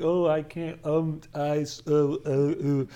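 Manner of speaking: halting fillers, imitating someone lost for words; mock stammering. Teacher mimics speakers tripped up by tough questions, stammering. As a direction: stumble and stall, tongue-tied. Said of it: "all tangled, "Oh, stammering".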